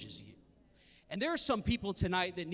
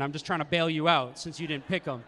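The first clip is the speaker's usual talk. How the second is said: normal talking voice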